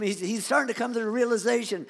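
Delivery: ordinary delivery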